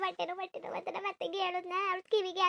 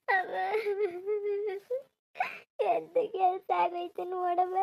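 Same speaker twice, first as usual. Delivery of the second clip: tearful wail; slower than usual. Acted. Daughter wails to mother over loss.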